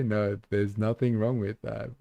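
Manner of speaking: ordinary talk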